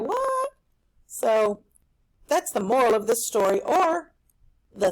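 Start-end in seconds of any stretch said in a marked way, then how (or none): 0.0-0.5 very high squeaky "What?"; reacting when unexpected help appears; astonished. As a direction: squeak it in total surprise. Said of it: "you're gonna be like".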